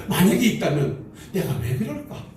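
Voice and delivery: ordinary talk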